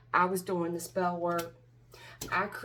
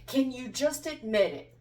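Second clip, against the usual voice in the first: louder, higher, insistent plea; exasperated demand; 5 semitones higher, 7 dB louder than usual. Real, not acted. Tarot reader pressing someone to confess, exasperated.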